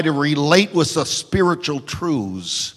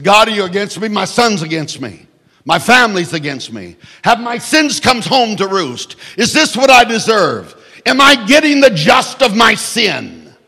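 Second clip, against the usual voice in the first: loud, higher desperate pleading; anguished cry to God; 6 semitones higher, 5 dB louder than usual. Retold or imitated. Preacher voicing David crying out to God. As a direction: plead louder and louder.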